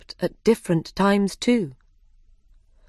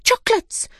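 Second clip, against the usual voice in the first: very high excited cry; 3 dB louder than usual. A narrator's reading. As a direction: squeal it out with glee. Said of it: "they cried".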